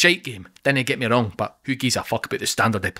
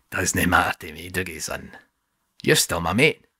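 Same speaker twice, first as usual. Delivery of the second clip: calm and unbothered; 6 semitones lower than usual. Acted. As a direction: brush it off gently. Aldo smug and oblivious.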